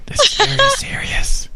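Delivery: mock-grave stage whisper. Co-host insisting on the hush as a joke, whispering. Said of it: "No more whispering".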